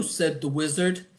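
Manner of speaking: ordinary delivery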